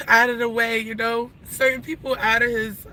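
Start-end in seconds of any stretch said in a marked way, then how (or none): none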